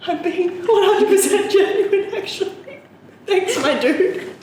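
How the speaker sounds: high-pitched protest. Retold or imitated. Convincing a guy it was not sarcasm, high protest. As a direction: squeal it in amused disbelief.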